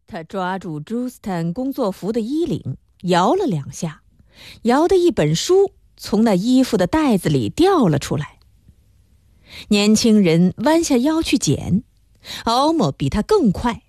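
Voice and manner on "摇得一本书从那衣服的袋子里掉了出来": usual speaking voice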